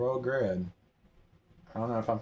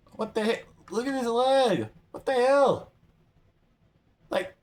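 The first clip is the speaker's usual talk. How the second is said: high-pitched, loud disbelief; incredulous high yelling; shocked outburst; 11 semitones higher, 5 dB louder than usual. Real, not acted. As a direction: exclaim in rising alarm at a bizarre sight. Shocked at character's broken-looking leg.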